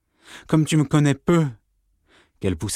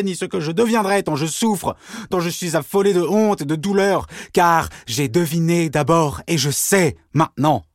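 higher, desperate confession; anguished outburst; 7 semitones higher than usual. Acted. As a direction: pour out the shame and pain.

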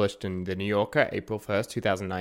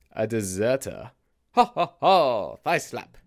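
hammy joke laugh; slower than usual. Acted. Punchline of his own joke.

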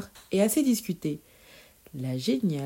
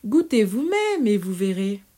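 mischievous; 3 semitones higher, 6 dB louder than usual. Acted. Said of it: "poursuivit Pierre malicieusement".

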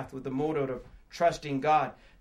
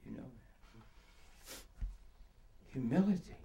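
soft, low, hesitant reading to oneself; halting and hushed, feeling for the word; quietly puzzling out a word; 7 semitones lower, 12 dB quieter, faster than usual. Acted. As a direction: sound out an unfamiliar word in a hushed voice. Sounding out words on a doorknob.

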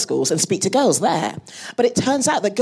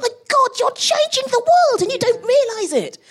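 amazed. Retold or imitated. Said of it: "I'm like".

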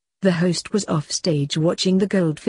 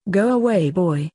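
ordinary delivery

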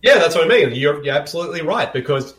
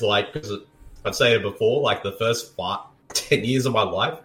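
normal talking voice

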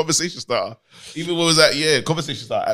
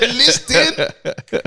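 laughing Listen; 11 semitones higher, 3 dB louder than usual. Retold or imitated. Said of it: "I said".

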